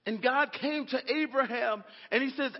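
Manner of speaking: ordinary talk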